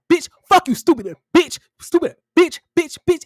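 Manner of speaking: high, loud burst of insults imitating a man's rant; mock going off. Host mimics a DJ going off at the reunion, mock angry rant. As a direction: spit out the insults as a hammed-up tirade. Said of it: "like, going off".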